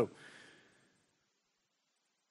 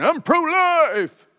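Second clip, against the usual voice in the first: mocking politician; 11 semitones higher, 4 dB louder, much slower than usual. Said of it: "jokers ... when they're campaigning saying".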